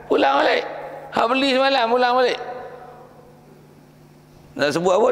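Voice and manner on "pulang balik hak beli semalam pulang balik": high, laughing mock buyer; laughing refund demand. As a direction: chuckle while demanding the money back. (minta duit balik sambil ketawa, suara tinggi)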